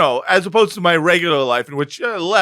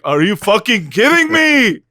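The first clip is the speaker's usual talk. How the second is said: high, loud, exasperated mock rant; imitating Bibi's inner outburst; 10 semitones higher, 5 dB louder than usual. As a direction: blurt it out, pitched high and indignant. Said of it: "Bibi is right now going like".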